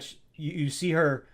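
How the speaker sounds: ordinary talk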